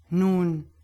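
a slow, drawn-out word; slowly. A narrator's reading. Doctor considering the priest's explanation, slowly. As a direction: stretch it out, weighing the answer. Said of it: "sagte der Doktor langsam".

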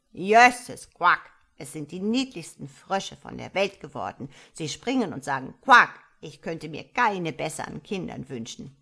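put-on loud, croaky old frog voice; the frog mother complaining at length. Old frog mother complaining to the starling, croaky and loud. Frog's long speech.

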